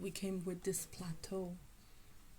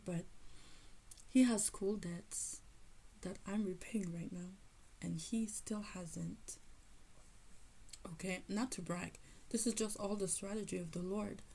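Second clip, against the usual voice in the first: normal talking voice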